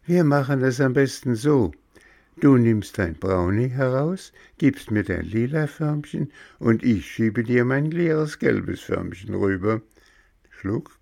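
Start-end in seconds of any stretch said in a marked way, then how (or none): 0.1-9.8 put-on higher, louder, quick girl's voice; Grünäuglein voiced. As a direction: rattle off the plan as the eager young elf. Grünäuglein schlägt Tausch vor. (höhere, schnelle Mädchenstimme)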